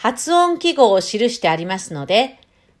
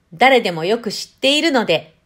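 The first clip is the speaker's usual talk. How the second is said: normal talking voice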